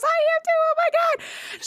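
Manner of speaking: very high imitated squeal; excited squeal. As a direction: squeal back in shared excitement. Voicing actress sharing the excitement.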